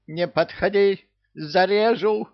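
high-pitched squawking bird threat; shrieking crazy parrot. A narrator's reading. Parrot warning off Люка with a pear, shrieking high. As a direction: yelp it like a frantic parrot. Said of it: "завопила взбалмошная птица".

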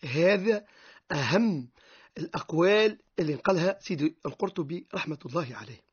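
ordinary talk, nothing marked

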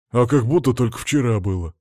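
plain reading voice

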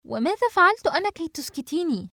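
high-pitched, affronted question; indignant protest. Acted. Samar protesting to Mona, indignant. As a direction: object at being silenced, voice pitched high. Acted quarrel, protesting being silenced.

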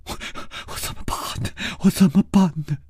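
a touch of sobbing, breaking on the words; a girl fighting back tears in despair; choked up. Acted. Girl face down on the bed, mildly choked with tears. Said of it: "她哽咽着说".

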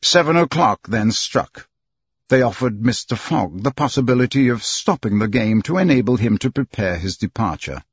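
plain reading voice

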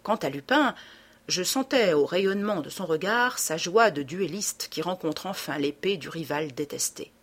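plain reading voice

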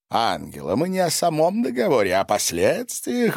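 plain reading voice

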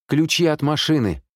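high, insistent demand; urgent demand. A narrator's reading. Amanda clarifies for the puzzled neighbour, urgent demand.

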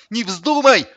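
high, loud cry of alarm; alarmed gasp. A narrator's reading. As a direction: shout to stop him, horrified. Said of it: "ахнул Сидор". (ахнув, в ужасе)

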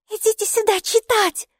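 very high-pitched summoning cry; calling out. A narrator's reading. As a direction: call the others over in a squeaky high voice. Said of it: "снова закричала Майки".

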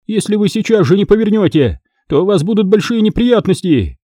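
high-pitched indignant threat; indignant captive. Acted. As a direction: warn them off in a high voice. Captive threatens her abductors.

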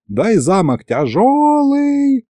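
very high, slow singsong; needling old man, dragging it high; drawn out. A narrator's reading. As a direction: stretch the last word right out. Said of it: "протянул дед".

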